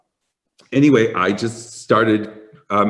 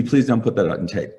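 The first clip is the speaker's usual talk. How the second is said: ordinary talk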